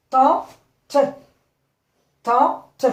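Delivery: "Tante" twice, split loudly into tan, te; syllable by syllable. Shown as a demonstration. Teacher confirming two syllables, syllable by syllable. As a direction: punch out "tan" and "te" separately in "Tante". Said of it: "Deux syllabes".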